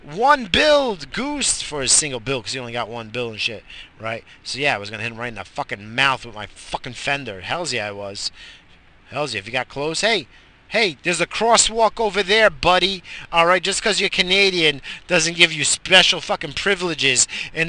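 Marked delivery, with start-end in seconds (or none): 0.0-1.6 high, loud playful call; mock-cheerful shout at a goose; friendly call out. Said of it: "You didn't hear me say". 10.0-15.5 loud, higher mock warning; yelling at jaywalking geese; calling out.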